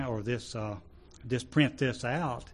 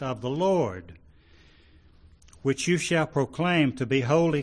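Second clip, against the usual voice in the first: loud, declaimed Bible reading; raised, emphatic reading voice; scripture reading; 3 semitones higher, 4 dB louder than usual. Acted. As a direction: proclaim the verse to a congregation. Reads scripture aloud to congregation.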